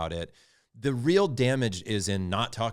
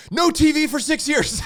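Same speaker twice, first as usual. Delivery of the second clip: loud, much higher punishment yelled through laughter; laughing mock decree; 11 dB louder than usual. Father laughing at his own over-the-top punishment, loud laughing mock decree. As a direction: ham up an absurd punishment while cracking up.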